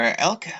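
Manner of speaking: Elka said as EL-kuh; unsure pronunciation. Shown as a demonstration. Host offering another guess at the name, unsure pronunciation of Elka as EL-kuh. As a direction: say Elka with a hard k and an "uh" ending. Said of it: "I don't know how to say it".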